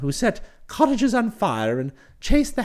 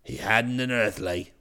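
much lower, hearty brash chap; cheery voice; 10 semitones lower, 5 dB quieter than usual. Acted. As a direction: hail him with cheery familiarity. Bingley voiced again.

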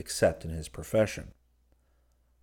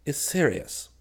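mildly mournful, pitched up; understated sadness in a higher voice; sad; 7 semitones higher than usual. A narrator's reading. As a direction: say it with rueful gravity. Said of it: "observed Clifford sadly".

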